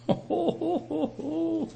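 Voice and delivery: short laugh pitched very high; high mocking laugh. Real, not acted. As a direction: laugh at the excuse. Laugh at Saul's excuse.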